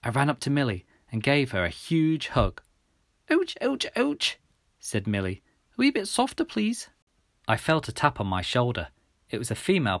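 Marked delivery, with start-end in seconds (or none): 3.3-4.4 very high little pained yelps; injured girl, pitch sky-high; hurt girl. Acted. Injured Milly hugged too hard. 5.7-6.9 put-on very high, small girl voice; hurt girl, high-voiced request; injured girl. Injured Milly asks for gentleness.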